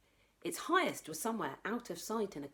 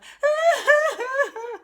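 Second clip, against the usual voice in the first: very high, loud crying wail; 14 dB louder than usual. Acted. Annabelle crying for Sputnik, loud wail. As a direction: howl like a distraught toddler. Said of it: "Annabelle cried louder".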